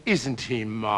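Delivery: low, soft little voice; little mother's voice. Retold or imitated. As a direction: murmur it like a proud mother in the stalls. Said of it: "a little voice from the front".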